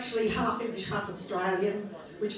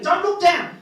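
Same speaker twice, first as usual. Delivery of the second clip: higher, louder playful warning; jokey louder warning to the audience; mock warning; 7 semitones higher, 8 dB louder than usual. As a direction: snap it at the front row in jest.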